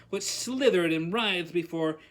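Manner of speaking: ordinary delivery, nothing marked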